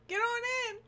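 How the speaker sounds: loud, high welcoming call. Real, not acted. Welcoming raiders.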